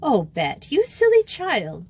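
exasperated scolding, swooping high; impatient rebuke, leaping up in pitch; rather impatient. A narrator's reading. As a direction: chide a silly child. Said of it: "rather impatiently".

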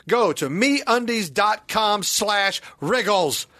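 slow, spelled-out promo code; slow promo read. Acted. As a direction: read the URL carefully.

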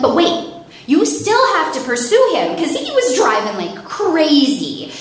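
high, loud, indignant protest; urgent insistence. Retold or imitated. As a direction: demand action from the officer. Caller insisting police give chase.

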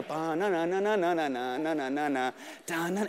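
low, rolling nonsense syllables; imitated voice. Nervous comic hears Jon approaching, low wordless imitation. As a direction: burble wordless chatter in a low voice. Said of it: "I know that voice".

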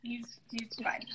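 whispered aside to camera; whispering. Real, not acted. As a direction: hush it, half to yourself. Aside about the dog.

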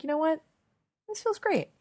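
high-pitched pleased little voice; retold delight. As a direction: lift it high, as if secretly won over. Retold pleased change of heart.

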